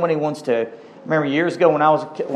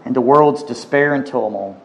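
low, reverent prayer voice; 3 semitones lower than usual. Real, not acted. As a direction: pray it humbly to God. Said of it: "Well, let's pray".

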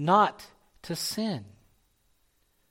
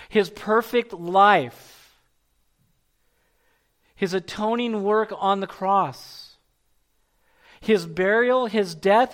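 raised, insistent sermon climax; full-force preaching; 4 semitones higher, 4 dB louder than usual. Real, not acted. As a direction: build the list with forceful emphasis.

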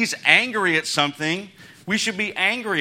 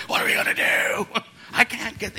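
mock panic; 7 semitones higher than usual. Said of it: "running around screaming".

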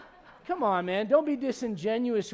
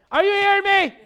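sudden high, very loud yell; exasperated wife; 12 semitones higher, 12 dB louder than usual. Retold or imitated. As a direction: imitate a fed-up wife yelling for attention. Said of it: "my wife's, like, talking to me".

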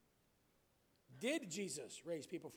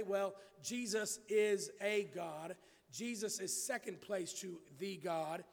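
slow, with "a" said as a long "ay" and stressed; stress on the articles; 4 dB louder, slower than usual. Retold or imitated. Preacher quoting what other religions say, stressing "a" and "the". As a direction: lean hard on "a" and on "the" as "thee". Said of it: "here's what many other religions and false cults will say".

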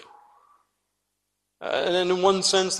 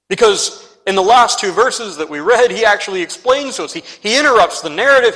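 higher, louder, fast preaching burst; fervent preaching; 5 semitones higher, 7 dB louder than usual. Acted. Preacher pressing the congregation on John's explanation. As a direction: drive it home with rising fervour.

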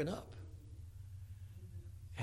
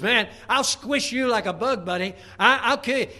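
loud, higher mocking threat; taunting giant; 6 semitones higher, 8 dB louder than usual. Goliath threatening young David, loud mocking taunt. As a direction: bellow it like a bully giant.

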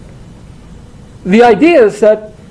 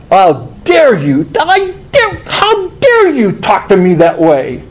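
slow, affronted Pharisee; slow indignant how dare you with a high break on dare; mock outrage; 3 semitones higher, slower than usual. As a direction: bristle like an insulted Pharisee. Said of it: "And it's like".

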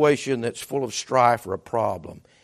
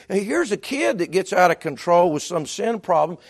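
ordinary talk

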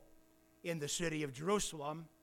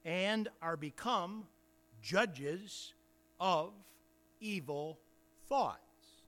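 deliberate Bible reading with long pauses; scripture reading. Real, not acted. Preacher reads the rebuke to brethren, emphatic scripture reading. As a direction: read it out phrase by phrase, pressing the key words.

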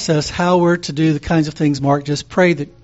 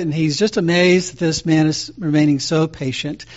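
usual speaking voice

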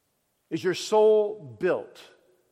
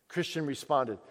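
usual speaking voice

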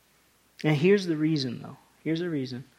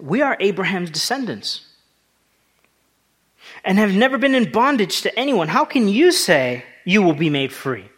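loud, raised-pitch indignant challenge; higher and louder, taking great offense; offended protest; 5 semitones higher, 7 dB louder than usual. Acted. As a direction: act out offended listeners objecting forcefully. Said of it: "They take great offense".

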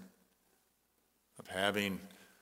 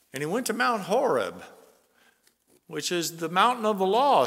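loud, high rising sermon delivery; fervent preacher crescendo; preaching at full force; 7 semitones higher, 6 dB louder than usual. Acted. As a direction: build the storm with each line. Builds the Elijah story loudly.

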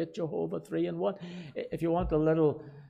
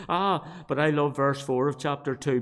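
ordinary talk, nothing marked

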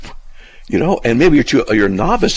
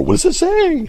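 high-pitched mock questioning; friend's mocking imitation. Friend mocks the teacher's pet question, mocking imitation. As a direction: mimic someone's pet question in a squeaky voice. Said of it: "he kind of mocks me that way".

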